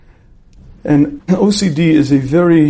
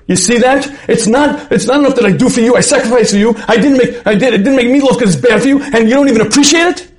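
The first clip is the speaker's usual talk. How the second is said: hammed-up high-pitched marital tirade; acted wife exploding at her husband; upset; 8 semitones higher, 3 dB louder than usual. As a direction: rant breathlessly, building to an indignant peak. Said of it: "she gets upset and have a big fight".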